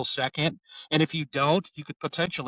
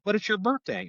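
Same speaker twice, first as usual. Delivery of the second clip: higher-pitched imitation of coaxing; retold coaxing; 8 semitones higher than usual. Cop to girl on her birthday, retold coaxing. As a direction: coax in a raised pitch, as retold dialogue.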